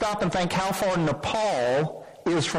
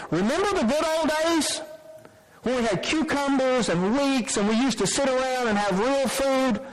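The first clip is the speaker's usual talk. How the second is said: put-on high, wistful longing for the old days; nostalgic grumbling Israelites; 7 semitones higher than usual. Preacher voicing the Israelites missing Egypt, high and wistful. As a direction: mimic the complaining people pining for Egypt. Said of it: "they would say to remember the good old days".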